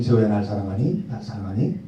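a touch of softly voiced Jesus; Jesus gently asking, lightly voiced; gentle Jesus. Pastor voicing Jesus to Seho, softly asking. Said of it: "주님이".

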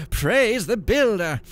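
high, loud, slow command; cried out. Acted. Monk commanding the horse, loud high cry. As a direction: call it out to the horse.

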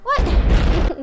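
loud, high, startled; shocked. Real, not acted. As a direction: blurt it out in shock. Shocked by the game.

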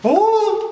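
sharp high-pitched ooh at the sour taste; high sour squeal. Real, not acted. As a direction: squeal as the sourness hits. Sour lemon bite reaction.

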